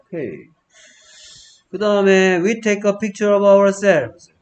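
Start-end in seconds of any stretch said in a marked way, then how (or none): 2.4-4.3 slow example reading. Shown as a demonstration. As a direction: read each word slowly and louder. Teacher reads example sentence.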